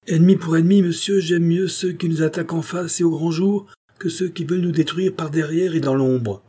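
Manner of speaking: high, indignant queen; defiant outburst. A narrator's reading. Queen defiantly rejects asking the Assembly. (indignation hautaine, voix haut perchée)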